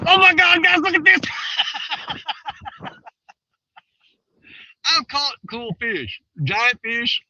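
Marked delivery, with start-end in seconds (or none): 0.1-1.2 high, loud thrilled exclamation; excited. Retold or imitated. To viewers showing off a big fish, thrilled and loud. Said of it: "Then you can go". 1.2-2.9 breathy laugh. Real, not acted. Laughing after the excited quote.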